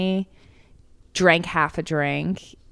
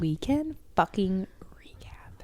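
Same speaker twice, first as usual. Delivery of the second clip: whispered segment title, playful; mock whisper; 9 dB quieter than usual. Co-host whispering the segment title for fun. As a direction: hiss it like a secret.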